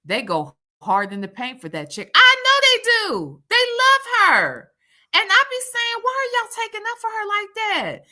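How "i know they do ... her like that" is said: high and loud, exasperated; teasing the fans who defend her; mock exasperation. Real, not acted. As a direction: throw up your hands at the fans.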